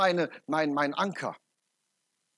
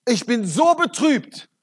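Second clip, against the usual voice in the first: gespielt betrübt; 6 semitones higher, 9 dB louder, slower than usual. Retold or imitated. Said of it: "und sagte".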